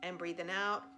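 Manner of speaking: calm meditation guide, long pauses between cues; guided meditation. Real, not acted. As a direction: gently lead them through a body scan, leaving room to breathe. Leads a body scan.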